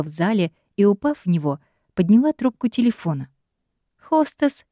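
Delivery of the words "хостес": bright, high, cheerful hostess greeting. Acted. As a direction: chirp it out gladly. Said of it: "радостно сообщила Камила". (радостное приветствие)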